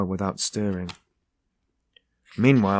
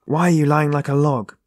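higher, louder angry scolding; wrathful shout; 5 semitones higher, 5 dB louder than usual. A narrator's reading. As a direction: shout at him to get up. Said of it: "she shouted".

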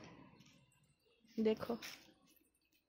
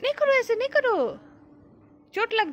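high, urgent plea to stop; worried, high-pitched caution; alarmed warning; 8 semitones higher than usual. Real, not acted. Mother stops child from getting hurt.

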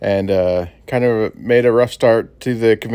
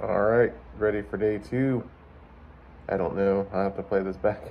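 muffled behind a mask; through fencing mask; 3 semitones lower, 11 dB quieter than usual. Real, not acted. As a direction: talk through a face mask. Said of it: "if you can hear me through this mask".

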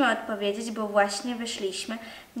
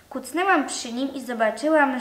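plain reading voice

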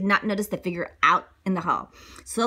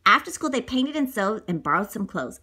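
plain reading voice

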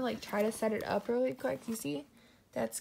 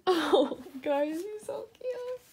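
high, slow cooing; 9 semitones higher, slower than usual. Real, not acted. Cooing over her baby.

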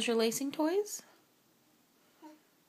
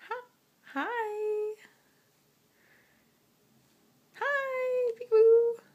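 high peekaboo voice; 13 semitones higher, 6 dB louder, much slower than usual. Real, not acted. Playing peekaboo with toddler.

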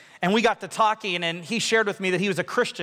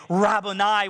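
usual speaking voice

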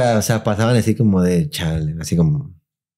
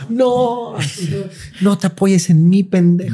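put-on high, slow old grandma voice with a laugh; 9 semitones higher, much slower than usual. Grandma scolding her grandson not to lean on her, high and old. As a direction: play the cranky grandma leaning on the stairs.

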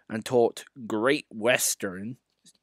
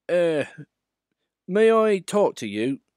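higher, hesitant little engine; small engine shyly addressing a famous one; shy Duck; 8 semitones higher, 5 dB louder than usual. Acted. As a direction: ask timidly, a bit raised. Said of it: "he asked shyly".

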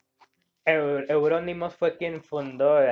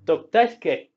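usual speaking voice

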